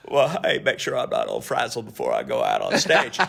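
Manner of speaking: very low Clinton impersonation; mimicking the president, pitched far down; Bill Clinton imitation. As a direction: sink down deep for the president's voice. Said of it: "I'll do my Clinton imitation".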